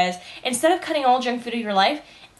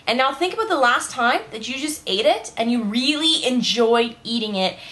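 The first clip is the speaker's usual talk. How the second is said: normal talking voice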